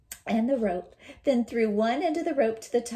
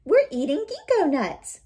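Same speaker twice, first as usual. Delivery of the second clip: put-on gleeful child; 8 semitones higher, 6 dB louder than usual. Calling down from tree.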